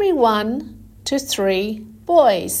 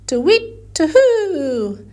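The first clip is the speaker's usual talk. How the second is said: owl hoot; 5 semitones higher, 4 dB louder than usual. Shown as a demonstration. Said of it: "the donkey said".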